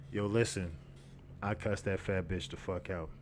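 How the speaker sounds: normal talking voice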